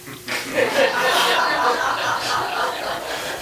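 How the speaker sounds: a long breathy laugh, high up; cracking up at his own story. Real, not acted. Storyteller cracking up while telling the joke, high laugh.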